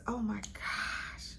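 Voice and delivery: slow, hushed swoon; awed whisper. Real, not acted. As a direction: whisper it, overcome by the scent. Overcome by the scent.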